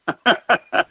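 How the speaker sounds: short real laugh. Real, not acted. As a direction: chuckle at the memory. Laughs at car TV memory.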